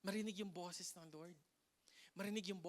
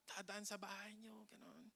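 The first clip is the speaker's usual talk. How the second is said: hushed whisper; 11 dB quieter than usual. Acted. Storm passing by your house.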